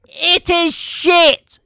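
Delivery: loud, high, drawn-out yell; shouting. Real, not acted. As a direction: bellow it out in disgust. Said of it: "if you get me started on football".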